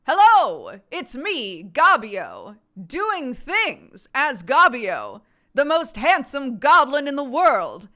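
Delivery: loud, proud goblin voice, drawn out; Gabio voice. Shown as a demonstration. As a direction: boast loudly as the most handsome goblin. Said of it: "this is how Gabio talks".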